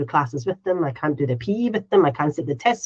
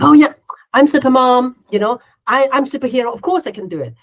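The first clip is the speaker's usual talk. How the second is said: mock boastful super mom; 6 semitones higher, 6 dB louder than usual.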